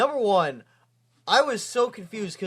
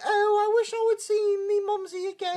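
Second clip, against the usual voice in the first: very high, put-on British nanny voice; hammed-up high nanny impression; 13 semitones higher than usual. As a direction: pipe it up like a wistful cartoon nanny. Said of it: "she's like".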